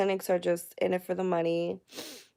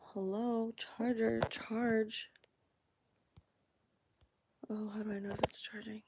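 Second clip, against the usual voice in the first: whispered aside; 2 semitones higher, 11 dB quieter than usual. Real, not acted. Fiddling with phone charger.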